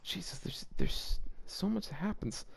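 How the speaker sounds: unvoiced dismayed whisper; overwhelmed hushed whisper. Real, not acted. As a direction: breathe it out in disbelief. Overwhelmed by long fanfic.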